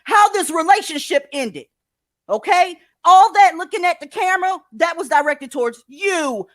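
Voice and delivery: loud, high-pitched, emphatic rant; heated rant. Real, not acted. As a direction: cut in and drive the point home loudly. Host cuts in to rant.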